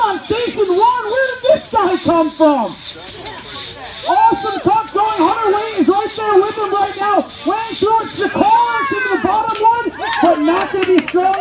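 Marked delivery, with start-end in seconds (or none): none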